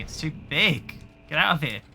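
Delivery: ordinary talk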